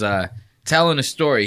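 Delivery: ordinary talk, nothing marked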